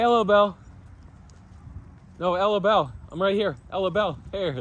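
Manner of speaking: high sing-song call to a dog; high pet voice. Real, not acted. Calling to the dogs.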